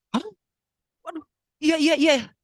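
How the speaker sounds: high, loud, hurried yeses; acted excited reply; flustered agreement. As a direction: blurt it out in a fluster. Flustered reply to news of son.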